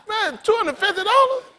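high laughing squeal of disbelief. Real, not acted.

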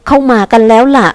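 lifted, lively announcement; a touch of excitement; excited. A narrator's reading. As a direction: announce the arrival eagerly. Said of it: "ได้พูดขึ้นอย่างตื่นเต้น".

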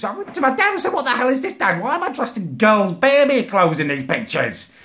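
shrill outraged questioning; outraged high pitch. Real, not acted. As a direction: demand answers in disbelief. Confronting dad, outraged.

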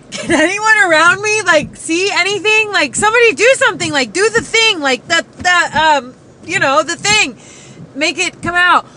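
high, loud panicked plea; panicked pleading. Retold or imitated. Retelling choking in car, panicked high plea. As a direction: beg for help as if choking. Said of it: "I was like".

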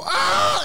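very high screamed ah; scream in face. Pretend. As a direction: scream right in his face. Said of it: "scream in his face really hard".

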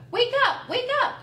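high, loud, urgent wake-up call; crying out. A narrator's reading. Ladybug waking Bear in her cave, crying out. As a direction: rouse a heavy sleeper. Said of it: "cried Ladybug".

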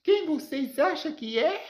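high-pitched indignant outburst; acted fury, high and accusing; furious. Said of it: "fica furioso e diz".

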